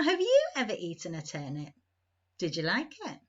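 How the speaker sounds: plain reading voice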